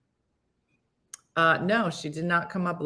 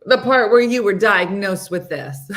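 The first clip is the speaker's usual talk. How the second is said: laughing; 2 semitones higher, 7 dB louder than usual. Real, not acted. Reply in the retold exchange.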